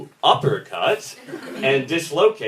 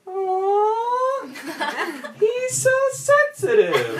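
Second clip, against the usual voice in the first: much higher and slower, swooning coo; cooing girls; much slower than usual. Retold or imitated. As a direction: coo it like a group of smitten girls. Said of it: "all the other girls in camp in unison went".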